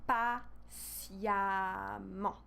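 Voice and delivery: PATIEMMENT very slowly as pa-sya-ment; correct pronunciation. Shown as a demonstration. Teacher applies the rule for learners, slow pa-sya-ment for patiemment. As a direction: stretch patiemment in three parts with an "ah". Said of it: "we change the sound with the sound A".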